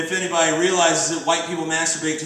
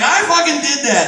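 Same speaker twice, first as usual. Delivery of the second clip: proud confession; 9 semitones higher, 6 dB louder than usual. Retold or imitated. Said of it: "And I'm like".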